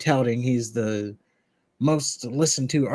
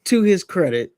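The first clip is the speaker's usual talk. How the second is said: louder, higher, taking the floor; voice of reason; 6 semitones higher, 6 dB louder than usual. Real, not acted. Taking over the argument from his co-host, louder and firmer. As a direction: cut in firmly over the co-host. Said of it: "Let the voice of reason take over here".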